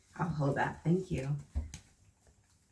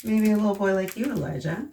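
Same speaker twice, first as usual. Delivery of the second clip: lively, louder picture-book storytelling voice; reading to children; 6 dB louder than usual. Acted. Teacher reading a picture book aloud to the children, lively storyteller voice. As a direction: read it out big and bright for the little ones.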